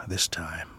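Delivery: low, soft, after a sigh; resigned echo. A narrator's reading. Parent echoing a worn-out hope, quietly resigned. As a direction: let it sink almost to a whisper.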